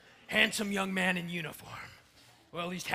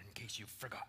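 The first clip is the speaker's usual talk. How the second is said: whispered aside; 7 semitones lower, 13 dB quieter, much faster than usual. Acted. Pointed aside.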